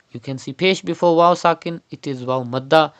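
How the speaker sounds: ordinary talk, nothing marked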